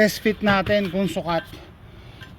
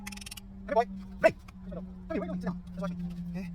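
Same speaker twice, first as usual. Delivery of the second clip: quiet coaxing; 13 dB quieter than usual. Real, not acted.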